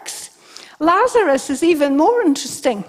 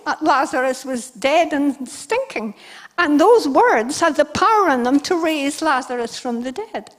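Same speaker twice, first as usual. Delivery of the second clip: ordinary delivery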